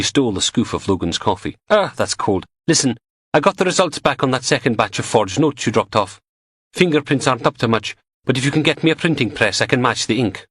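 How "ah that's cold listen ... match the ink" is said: lightly voiced young goth woman, a little higher and chatty; a hint of a cheeky goth lab tech; [name] voiced. A narrator's reading. Goth tech teasing Logan over coffee, lightly voiced.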